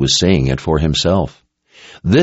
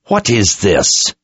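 menacing voice; 4 semitones higher, slower than usual. A narrator's reading. Soldier possessed, voice different.

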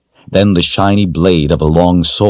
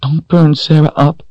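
put-on much higher, pleading child's voice; tearful little girl; 11 semitones higher than usual. A narrator's reading. Frightened girl begging the woman to spare her doll, tearful plea. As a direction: beg like a scared little girl. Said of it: "her eyes filling with tears".

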